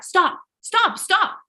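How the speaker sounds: imitating a lady shouting stop; calling stop. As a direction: call out to halt them.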